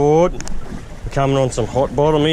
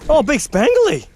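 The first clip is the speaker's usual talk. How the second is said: much higher and slow, wide-eyed; amazed; 9 semitones higher, much slower than usual. Real, not acted. Fisherman sees a huge spangled emperor, slow amazed exclaim.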